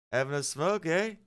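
put-on high, lilting Canadian accent; mock Canadian accent. Retelling Canadians at truck stop, mock Canadian accent. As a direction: put on a Canadian "eh" with a rising end. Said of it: "They're like".

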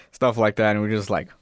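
ordinary talk, nothing marked

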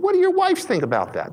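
high, pointed question; incredulous, high. Retold or imitated. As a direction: ask it in shocked disbelief. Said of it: "I said".

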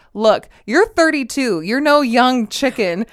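loud, high imitation of an insult; mock blunt put-down. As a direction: deliver his dig loudly, playing it up. Retells husband's insult about looks.

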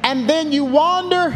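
rising, building sermon cadence with long held words; fiery preaching. Acted. Pastor preaching to his church, climbing, full-force sermon delivery. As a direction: preach it out to the congregation, pitch climbing.

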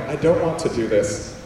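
normal talking voice

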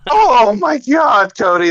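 drawn-out, high-pitched tearful wail; mock distraught. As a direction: overplay being overcome, slow and high. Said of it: "You don't sound so well".

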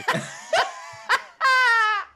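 helpless high-pitched laughter; high squealing laugh. Real, not acted.

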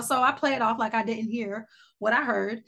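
normal talking voice

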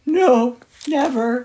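loud, lightly put-on animal voice; animal character. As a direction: deny it firmly as a storybook animal. Frank answers loudly in story.

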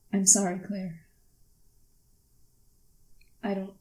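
subdued, halting apology; tearful apology. Acted. Daughter apologises to Claire as tears fall, subdued and halting. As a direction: falter softly between long pauses. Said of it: "The tears drop from her face".

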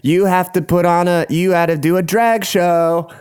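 high, hammed-up imitation of hosts; drag hosts' decree. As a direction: proclaim the penalty like a drag hostess. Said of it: "They're like".

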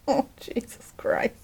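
breathy shocked whisper; whispered disbelief. Real, not acted. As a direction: mutter it in disbelief. Reacts to mask story.

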